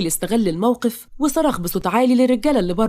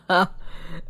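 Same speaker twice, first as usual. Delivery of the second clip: mocking villain laugh; 5 dB quieter than usual. Villain mocks the lovers' reunion, sneering laugh.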